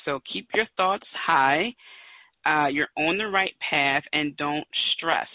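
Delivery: slowed, word by word; slow list of the number's meanings; slow reading of meanings. Shown as a demonstration. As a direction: spell out each meaning slowly. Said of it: "Put it in a sentence".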